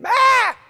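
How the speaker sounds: put-on very loud, high-pitched baby shriek; imitated baby scream. Showing how a baby finds its voice, screaming. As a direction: scream like a baby demanding attention. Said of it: "By screaming".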